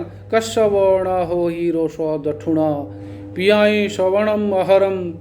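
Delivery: slow, measured chant of the verse; verse recitation. Shown as a demonstration. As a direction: intone each syllable slowly.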